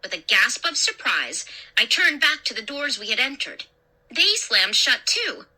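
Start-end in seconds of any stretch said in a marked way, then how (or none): none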